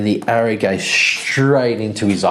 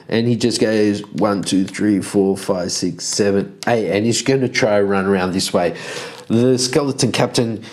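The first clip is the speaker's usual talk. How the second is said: usual speaking voice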